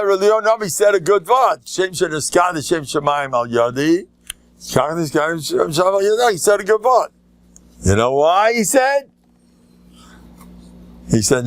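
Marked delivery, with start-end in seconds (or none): 0.0-9.1 emphatic retelling. Retold or imitated. Rabbi's forceful reply retold.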